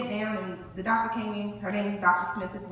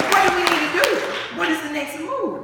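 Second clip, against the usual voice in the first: loud demand; 7 semitones higher, 7 dB louder than usual. Retold or imitated. Demanding next steps from doctor.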